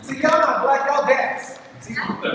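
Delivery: ordinary talk, nothing marked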